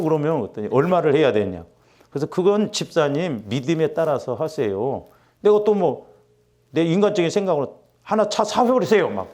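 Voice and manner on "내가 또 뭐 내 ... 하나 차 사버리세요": exasperated retort. Pretend. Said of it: "막 그러고 싶을더라고".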